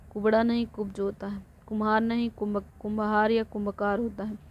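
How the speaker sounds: usual speaking voice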